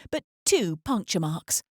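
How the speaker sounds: usual speaking voice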